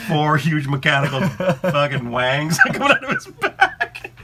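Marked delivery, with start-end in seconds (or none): none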